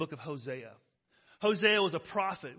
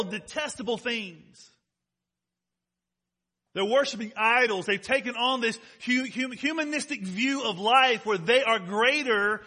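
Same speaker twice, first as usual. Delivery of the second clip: raised, emphatic pulpit delivery; preaching; 4 semitones higher, 6 dB louder than usual. Real, not acted. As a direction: drive each point home to the congregation.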